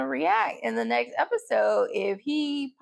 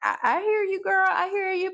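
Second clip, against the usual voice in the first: excited higher reply; 8 semitones higher than usual. Retold or imitated. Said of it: "I was like".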